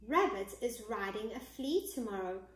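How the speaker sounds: ordinary delivery, nothing marked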